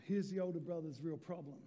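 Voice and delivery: ordinary talk, nothing marked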